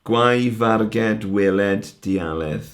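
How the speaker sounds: slow, drawn-out Welsh verse line; poem recitation. Shown as a demonstration. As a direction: read the line out slowly and deliberately. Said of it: "let's break it down a little bit more".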